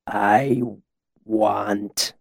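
dazed words with long gaps; halting, thick-tongued speech; slow, thick voice. A narrator's reading. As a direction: drag each word out thickly, as if befuddled. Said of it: "his voice slow and thick".

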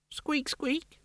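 very high, soft squeaky voice; tiny squeaky mouse. Acted. Rat ghost squeaking.

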